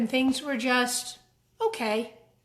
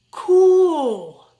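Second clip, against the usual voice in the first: louder, higher, drawn-out gush of admiration; loud admiring cool; 5 semitones higher, 11 dB louder than usual. Acted. Kid bean marvelling at the guitar player, loud awe. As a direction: gush it, starstruck.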